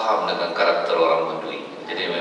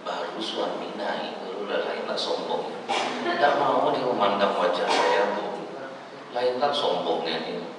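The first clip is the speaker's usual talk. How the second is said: gossiping hush; 6 dB quieter than usual. Acted. A mother complaining to her neighbour about the proud wife, hushed. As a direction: lean in and gossip quietly.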